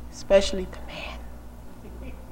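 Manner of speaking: slow, near-whispered aside; hushed aside. Pretend. Preacher to women about talking to men, hushed aside. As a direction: let it fade into a playful whisper.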